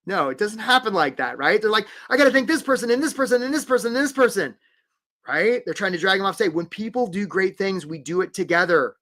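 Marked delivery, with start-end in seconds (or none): 2.1-4.6 fast, high, gushing list of thanks; mock-frantic award winner; rushed acceptance speech. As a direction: race through it before being dragged offstage. Acts winner rushing through thanks.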